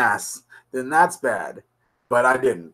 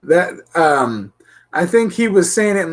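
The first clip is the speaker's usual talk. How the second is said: normal talking voice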